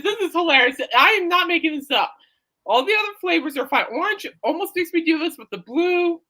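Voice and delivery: ordinary talk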